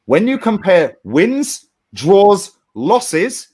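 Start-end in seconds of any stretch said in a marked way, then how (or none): none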